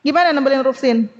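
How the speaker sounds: ordinary talk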